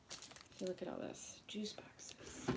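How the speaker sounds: whispered aside, trailing off. Real, not acted. Showing the groceries to viewers, in a whisper. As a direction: breathe it as a secret.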